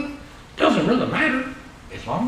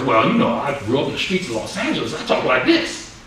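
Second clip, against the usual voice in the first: Los Angeles street accent; 8 semitones lower than usual. Shown as a demonstration. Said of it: "Why don't you sh-, demonstrate that for us?".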